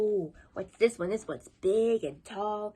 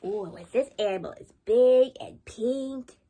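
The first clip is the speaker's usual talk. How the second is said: usual speaking voice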